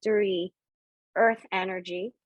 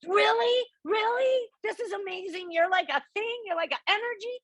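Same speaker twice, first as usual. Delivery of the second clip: much higher, giddy disbelief; excited amazement; 12 semitones higher than usual. Retold or imitated. Speaker marvels that Patience is an energy, excited and high-pitched. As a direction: pitch leaps right up in thrilled wonder. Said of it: "so I was so excited".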